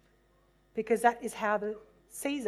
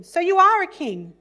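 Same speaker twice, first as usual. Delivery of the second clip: incredulous question; 8 semitones higher, 11 dB louder than usual. Acted. Pilate questions Jesus.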